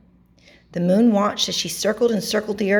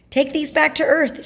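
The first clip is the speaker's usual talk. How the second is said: higher storybook character voice; lightly voiced moon; 7 semitones higher than usual. A narrator's reading. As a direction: lightly lift the pitch for the moon. Moon gives gifts to astronauts.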